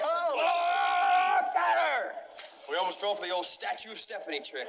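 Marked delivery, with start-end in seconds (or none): 0.0-2.1 high, loud yell; loud, high burst of triumph; excited shout. Real, not acted. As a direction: shout at the grab. Tackling during the game.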